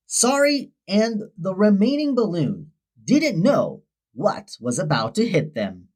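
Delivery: ordinary delivery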